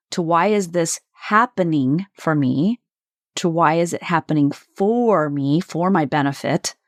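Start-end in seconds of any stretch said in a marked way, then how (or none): 0.2-2.8 "happening" set apart and leaned on; stress on HAPPENING. Shown as a demonstration. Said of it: "emphasis on each word in the sentence shifts". 3.5-5.6 "for" stretched out and leaned on; stress on FOR. Shown as a demonstration. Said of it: "emphasis on each word in the sentence shifts".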